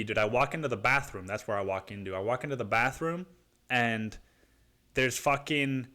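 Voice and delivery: usual speaking voice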